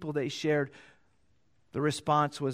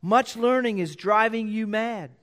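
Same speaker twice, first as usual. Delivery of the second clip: loud, higher, slowing rebuke to Paul; 5 semitones higher, 5 dB louder than usual. Acted. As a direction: call Paul out, loud and raised. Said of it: "Festus said with a loud voice".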